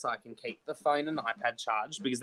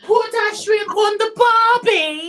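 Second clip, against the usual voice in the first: put-on screeching, drawn-out falsetto Australian accent; bad Australian accent; 14 dB louder, slower than usual. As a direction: shriek it in a hammed-up Australian accent. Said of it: "just like Monty Python doing a woman".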